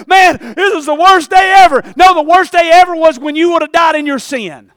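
loud, high shouted preaching; preacher shouting at the congregation. Acted. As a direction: shout the warning at them. Shouting sermon climax.

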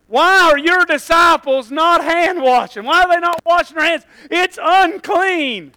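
loud, high indignant accusation; mad mock Pharisees. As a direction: act out outraged rule-keepers, drawing out the last word. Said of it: "these people were so mad".

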